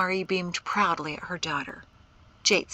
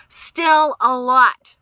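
slow, loud, high bellow; 6 semitones higher, 12 dB louder, much slower than usual. A narrator's reading. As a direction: bellow each word heavily. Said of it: "Shem bellowed".

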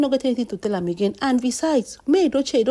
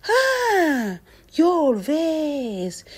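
mock surprise; 6 semitones higher, much slower than usual. Real, not acted. Mocking reaction.

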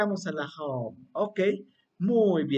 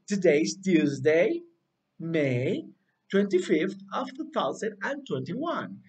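slow model phrase; slower than usual. Shown as a demonstration. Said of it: "¿Me ayudas a repetir?".

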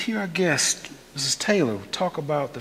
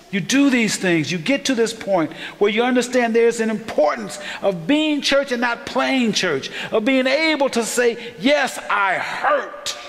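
rising, higher, louder preaching; preaching at full force; 8 semitones higher, 4 dB louder than usual. Acted. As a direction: build the sermon to its peak. Preaching climax.

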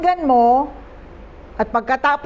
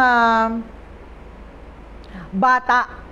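ordinary talk

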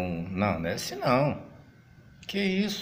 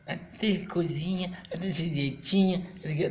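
put-on wrong Minas accent; 5 semitones higher, 5 dB quieter than usual. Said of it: "não, mineiro não fala assim".

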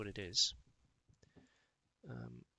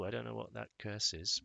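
normal talking voice

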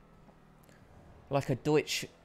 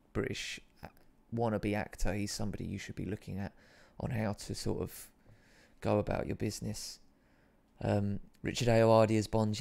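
ordinary delivery

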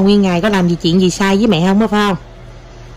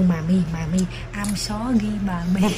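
soft imitation of child; 8 dB quieter, slower than usual. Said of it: "con kêu".

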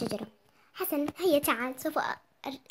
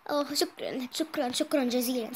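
ordinary delivery, nothing marked

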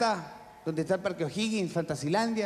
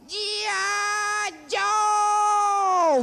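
high, drawn-out action-hero yell; heroic shout; 13 semitones higher, 3 dB louder, much slower than usual. Acted. Motorbike cop charging into action, high drawn-out heroic shout. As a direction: shout it high like a battle cry. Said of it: "y grita".

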